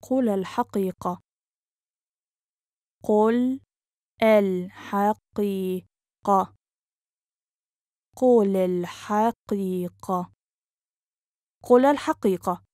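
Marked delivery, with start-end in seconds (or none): 3.0-5.8 syllable by syllable. Shown as a demonstration. Teacher breaks phrase into syllables. 8.2-10.3 measured, careful teaching pace. Shown as a demonstration. Teacher repeats phrase slowly joined. 11.6-12.6 quick, flowing and joined up; fast model sentence; natural speed. Shown as a demonstration. Teacher repeats phrase at speed.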